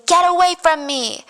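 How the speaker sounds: high retold yell, held back in volume; mock scream. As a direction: imitate her screaming him away. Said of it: "And she's screaming".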